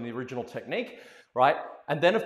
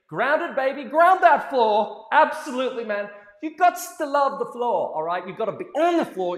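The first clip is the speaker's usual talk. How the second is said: high and loud, hyping the class; ground that floor stretched out long and loud; hyped-up coaching; 7 semitones higher, 8 dB louder than usual. Real, not acted. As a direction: fire them up about the floor. Hyping the dancers up.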